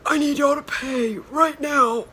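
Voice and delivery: high, loud put-on voice of an older man demanding payment; imitating the parking attendant, loud and high; stern parking attendant. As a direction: bark the order like a no-nonsense meter guy. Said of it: "dead ass, that's how he sounded".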